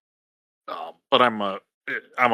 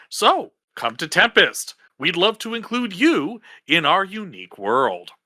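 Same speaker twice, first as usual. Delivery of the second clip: upbeat sponsor read, pitched up; 8 semitones higher than usual. Acted. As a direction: sell the kingdom like a tourism ad.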